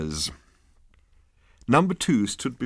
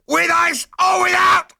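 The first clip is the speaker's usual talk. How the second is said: much higher and louder scream; screaming lackey; 9 dB louder than usual. A narrator's reading. Number two screams the drink order at the prisoners. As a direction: scream the offer like a threat. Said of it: "bellowed number two".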